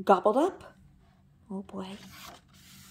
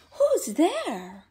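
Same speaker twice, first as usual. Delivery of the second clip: higher, squeaky little mouse; 6 semitones higher, 4 dB louder than usual. Acted. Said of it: "The mouse squeaked".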